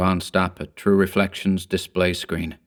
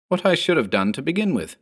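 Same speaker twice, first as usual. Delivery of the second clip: a hint of a calm clone, quicker; 8 semitones higher, faster than usual. A narrator's reading. Clone answers Mark, a touch brisk. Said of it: "the doppelganger answered".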